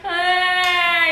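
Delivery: high, sustained wail; comic held "aah" of a crying child; mock bawling. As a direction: imitate a kid crying out, drawn out. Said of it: "he's, like, crying, he's bawling".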